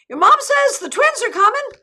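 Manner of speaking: very high, loud, loose Scottish imitation; Marion's Scottish brogue. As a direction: sing out news in an attempted Scottish lilt. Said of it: "in her Scottish brogue that I cannot imitate".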